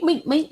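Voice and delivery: high-pitched rapid-fire mink sounds; imitated punch noises. Commentator imitating a fighter's jabs, high staccato noises. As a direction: rattle off high little jab noises.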